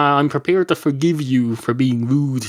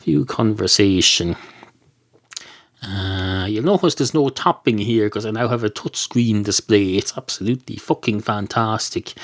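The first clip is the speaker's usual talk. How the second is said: normal talking voice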